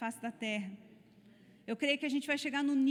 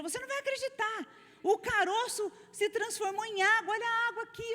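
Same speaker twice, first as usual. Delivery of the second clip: higher and louder, bursting with amazement; healed woman phoning her friend, overjoyed and amazed; excited disbelief; 9 semitones higher, 4 dB louder than usual. Retold or imitated. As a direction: tell your friend the lump is gone, thrilled. Woman calls friend after healing.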